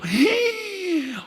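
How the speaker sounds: a sharp high gasp of mock surprise; high surprised gasp. Pastor retelling meeting a stranger, mock surprised gasp. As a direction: gasp as if thrilled to find a neighbor. Reacting to shared hometown.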